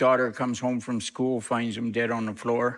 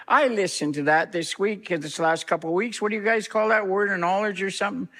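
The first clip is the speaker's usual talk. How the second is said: higher, worked-up retelling of a friend's challenge; animated; 8 semitones higher than usual. Retold or imitated. Matt challenging the pastor at dinner, worked up and higher. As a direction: press the point, voice up. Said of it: "all of a sudden got animated".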